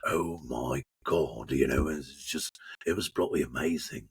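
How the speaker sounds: awed words in a deep English trailer voice; deep English priest voice; Padre character voice. Pretend. Still in his Padre voice.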